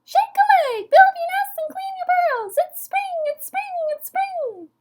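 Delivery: very high squeaky frog shout; tiny frog rousing everyone at full pitch; excited, sky-high peeper voice; shouting little frog. A narrator's reading. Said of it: "he shouted".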